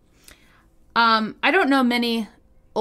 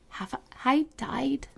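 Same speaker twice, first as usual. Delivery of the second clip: soft, slow and quavering; trembling voice; 9 dB quieter, slower than usual. A narrator's reading. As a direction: let the voice tremble, quiet and slow. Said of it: "in a trembling voice".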